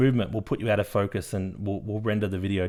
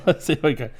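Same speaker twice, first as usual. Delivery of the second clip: laughing; 5 semitones higher, 4 dB louder, faster than usual. Real, not acted. Laughing before doing impression.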